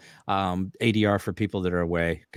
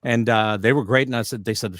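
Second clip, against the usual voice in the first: ordinary talk, nothing marked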